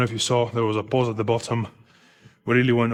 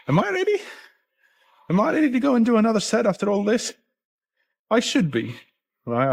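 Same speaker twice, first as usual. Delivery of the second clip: much higher, doubtful self-questioning; anxious self-doubt; 11 semitones higher, faster than usual. Retold or imitated. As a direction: voice your nerves before the set. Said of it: "and I'm like".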